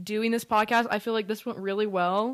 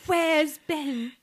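higher-pitched mock British mom; imitated English accent; 6 semitones higher than usual. Sister echoing the mom's question, imitated English accent. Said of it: "I can't even do the accent".

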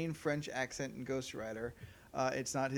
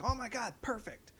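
higher-pitched imagined gush; mock delight; 8 semitones higher than usual. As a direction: pretend it's an instant perfect match. Acting out an instant delighted reaction.

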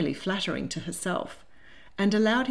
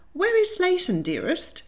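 high-starting, polite question; lightly voiced lady of the house; 12 semitones higher, 4 dB louder than usual. A narrator's reading. Lady asks her suitor about the butler, lightly voiced and high. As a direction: ask as a doting lady, pitch falling away.

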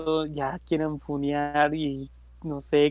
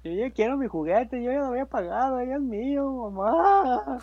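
put-on higher, whiny kid begging mom; whiny child; 7 semitones higher than usual. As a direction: whine to mom like a spoiled kid. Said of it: "como niño en berrinche".

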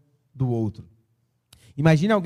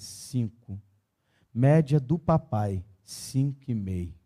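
leitura comovida; 5 semitones lower than usual. Acted. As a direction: read each grade gently, letting it sink in. Lê o boletim, suave e comovido. (leitura suave e comovida, mais baixa)

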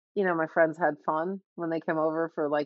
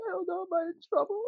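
put-on high, small voice of a worried child; worried kid; 12 semitones higher, much faster than usual. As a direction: ask timidly like a child fearing trouble. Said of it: "I'm like".

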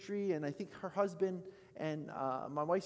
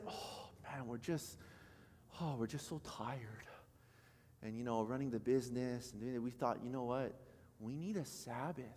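tired sigh; 5 semitones lower, 8 dB quieter than usual. Retold or imitated. Weary couple quoted by pastor.

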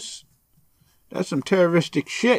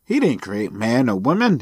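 ordinary delivery

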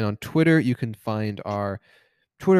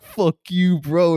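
put-on loud, higher drunken bravado; mock defiance; 6 semitones higher, 6 dB louder, much slower than usual. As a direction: act out a reckless challenge to the killer. Imitating a drunk guy charging killer.

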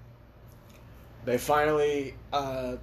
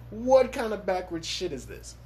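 retold disbelief; 6 semitones higher than usual. Inner panic retold.

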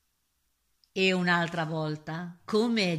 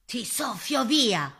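raised, slow threat; threatening wolf; 5 semitones higher, 4 dB louder, slower than usual. A narrator's reading. Wolf threatens to blow the pig's house away, menacing. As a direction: menace him, drawing the words out. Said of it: "lo minaccia quello".

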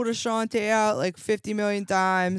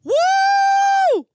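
excited cheer; 6 dB louder than usual. Real, not acted. Cheering news of mammoths.

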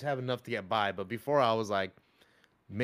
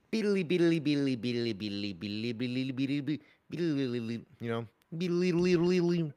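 guitar impression; 3 semitones higher than usual. Shown as a demonstration. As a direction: mimic a guitar solo with your tongue. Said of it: "impression of a guitar".

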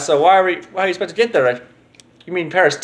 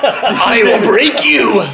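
very slow, louder villain growl; Bane voice; 6 semitones higher, 6 dB louder, much slower than usual. Pretend. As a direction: drag each word out menacingly. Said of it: "See, I can't do it".

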